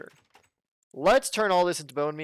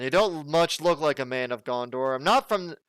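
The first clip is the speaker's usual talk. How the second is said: ordinary talk, nothing marked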